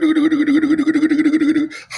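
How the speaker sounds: high loud rattling cackle; Wicked witch cackle. Retold or imitated. Imitating the Wicked witch with a loud high cackle. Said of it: "she was like".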